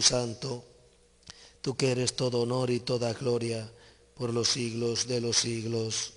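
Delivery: firm, resounding liturgical doxology; solemn closing prayer. Real, not acted. Entrusting the sick and their families to God, solemn and emphatic. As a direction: seal the prayer with conviction, phrase by phrase.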